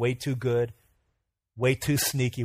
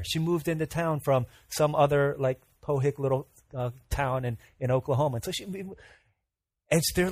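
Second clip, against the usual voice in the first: usual speaking voice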